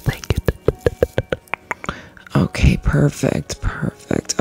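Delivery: soft breathy ASMR whisper. Acted. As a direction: whisper close and gently.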